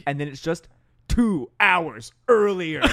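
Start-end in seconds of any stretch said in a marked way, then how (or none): 1.1-2.8 dramatic trailer voice. Retold or imitated. Dramatic title card read.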